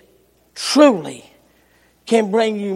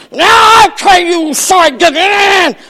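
grumbling; 8 semitones higher, 8 dB louder than usual. Acted. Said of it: "you grumbling".

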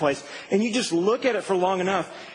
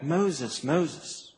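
softer, tender calling of the name; calming, endearing call; 3 semitones lower, 4 dB quieter than usual. Shown as a demonstration. God calling Moses by the bush, tender and softer. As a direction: draw him in gently with his name. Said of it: "like a calming effect".

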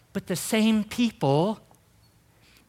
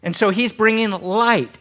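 ordinary talk

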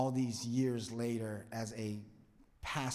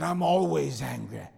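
louder retold Banner line; 3 semitones higher, 10 dB louder than usual. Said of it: "He said".

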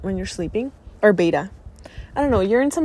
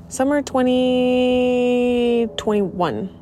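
drawn out recalling; 4 semitones higher, much slower than usual. Real, not acted.

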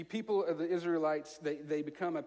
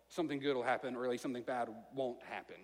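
ordinary talk

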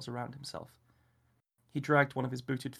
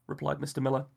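ordinary delivery, nothing marked

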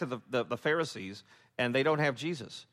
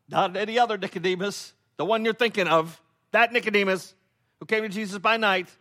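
higher and louder, pressing each phrase; emphatic; 7 semitones higher, 6 dB louder than usual. Real, not acted. Preacher excitedly stressing to congregation it is that Nicodemus. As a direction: hammer home which Nicodemus. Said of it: "preacher emphatic build".

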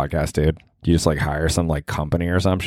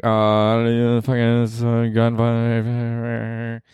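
dumb guy voice; 4 semitones higher, much slower than usual. Retold or imitated. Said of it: "If a guy said that".

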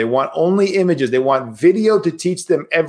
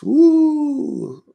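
long, high-pitched "woo"; playful high whoop; mock-delighted woo; 12 semitones higher than usual. Mock delight at one's own thoughts.

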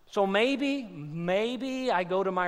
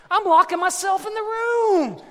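high, loud holler; comic shouted confession; 11 semitones higher, 7 dB louder than usual. Real, not acted. Dad confessing he hides from his kids, shouting.

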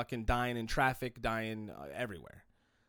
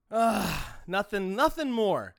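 ordinary delivery

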